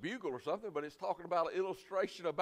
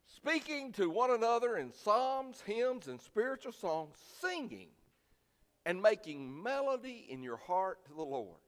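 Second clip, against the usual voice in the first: scripture reading; 7 semitones higher, 4 dB louder than usual. Acted. Pastor reading Ephesians to the church: raised solemn scripture reading. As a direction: read it out as holy writ.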